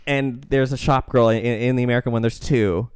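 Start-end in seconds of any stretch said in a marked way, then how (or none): none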